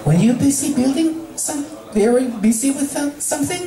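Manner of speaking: Fluttershy, unsure and curious; timid Fluttershy. Acted. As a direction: ask without prying.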